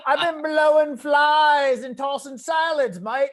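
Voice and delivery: over-the-top Aussie bloke impression; fake Brit-Aussie accent. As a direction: shout mate like a caricature Aussie. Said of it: "I thought I did a good job".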